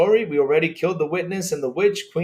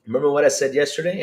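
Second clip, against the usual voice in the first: ordinary talk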